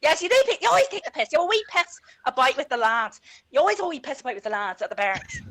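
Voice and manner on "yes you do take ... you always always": put-on higher, louder Belfast accent, lads' talk; thick Belfast accent on request. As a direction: tell it like a Belfast lad. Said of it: "do your Belfast accent".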